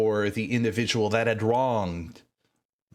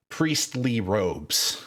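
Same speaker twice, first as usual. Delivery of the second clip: ordinary talk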